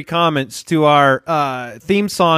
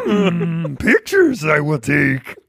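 Yoda voice; 4 semitones lower, much slower than usual. Pretend. Yoda asked for photo.

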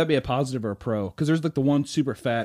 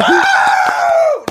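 laughing scream; 12 dB louder than usual. Real, not acted.

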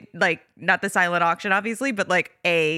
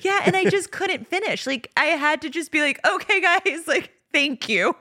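laughing; 10 semitones higher than usual. Real, not acted. Laughing about crying on stage.

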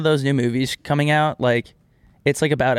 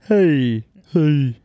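put-on [name] accent; 3 dB louder than usual. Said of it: "your best [name] accent".

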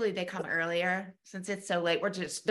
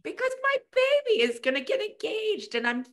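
high-pitched mock wail; tearful; 10 semitones higher than usual. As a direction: whine it like a mother losing her baby. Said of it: "crying, cooking my chicken".

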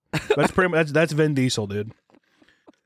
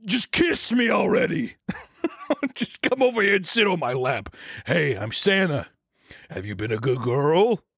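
Vin Diesel creep; 4 semitones higher than usual. Retold or imitated. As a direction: shout it like a sleazy flirt. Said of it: "he's like".

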